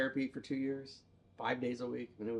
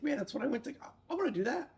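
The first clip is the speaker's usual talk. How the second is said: excited; 11 semitones higher, faster than usual. Retold or imitated. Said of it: "I was like".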